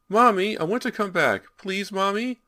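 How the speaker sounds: put-on high, loud child's cry; a lost boy crying out from the dark; crying little boy. A narrator's reading. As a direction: call out for mommy, desperate and scared. Ghost boy begging.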